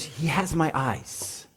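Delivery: put-on soft, slow mom cooing over the baby; a hint of a tender new mother; doting mom. New mom admiring her baby, softly doting. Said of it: "the mom goes".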